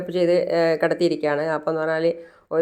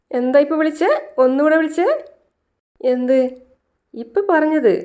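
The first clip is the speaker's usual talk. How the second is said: much higher, playful teasing; teasing high delight; 9 semitones higher than usual. Retold or imitated. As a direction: coax him to say it again, high and playful. Teasing him to repeat "molé.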